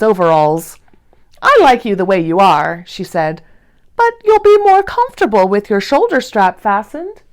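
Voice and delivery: gentle read-aloud storytelling voice; lively read-aloud for young listeners; story narration. A narrator's reading. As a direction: read it like a bedtime picture book for kids. Reads Corduroy story aloud.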